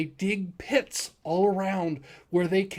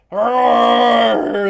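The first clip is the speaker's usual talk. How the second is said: loud shouted roar; dragon roar; 3 semitones higher, 13 dB louder than usual. Acted.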